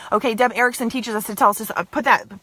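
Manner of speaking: usual speaking voice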